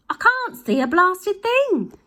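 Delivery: high, loud, slow yell. Acted. Husband yells from the driving seat, high and loud. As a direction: yell it in frustration. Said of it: "yelled Mister Glutton".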